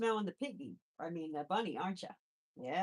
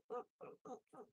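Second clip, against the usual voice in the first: guinea pig noise; 11 dB quieter, much faster than usual. Retold or imitated. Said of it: "like that".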